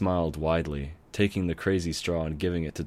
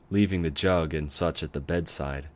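ordinary delivery, nothing marked

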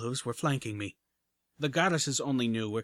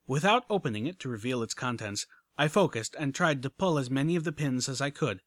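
ordinary delivery

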